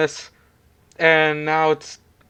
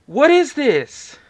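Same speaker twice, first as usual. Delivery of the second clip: surprised; 11 semitones higher, 6 dB louder, much slower than usual. Real, not acted. Startled at game screen.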